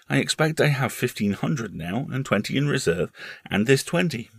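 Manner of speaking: put-on high, matter-of-fact child voice; earnest small boy. Boy reckoning his winnings for his uncle, earnest. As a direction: count it up like a serious boy.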